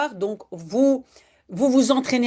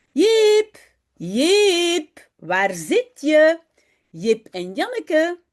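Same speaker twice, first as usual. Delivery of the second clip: calling out; 4 semitones higher, much slower than usual. Acted. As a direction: call the children home from afar. Mother calling for missing children.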